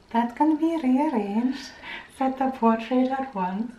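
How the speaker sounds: slow, badly faked Welsh accent; drawn-out attempt at a Welsh accent. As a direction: try a careful Welsh lilt. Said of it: "That's my Welsh accent".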